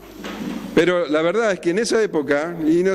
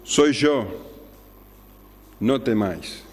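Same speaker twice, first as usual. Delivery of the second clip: calm reassurance; 5 semitones lower, slower than usual. Retold or imitated. Jesus to the terrified disciples, slow calm reassurance. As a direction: soothe frightened men in a steady voice. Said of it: "Qué palabras tan tranquilas".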